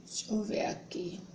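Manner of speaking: soft unvoiced whisper while searching; teacher muttering to herself mid-task. Real, not acted. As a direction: whisper it under your breath while looking.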